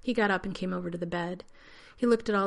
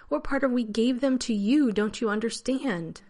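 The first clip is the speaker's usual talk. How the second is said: angry; 5 semitones higher, 5 dB louder than usual. A narrator's reading. Angry argument over gifts.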